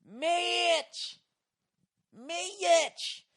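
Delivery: high, stretched two-syllable call across the arena; high, loud, drawn-out Mitch; mocking drawn-out yell. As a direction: imitate Dallas girls calling out Mitch. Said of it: "They were going".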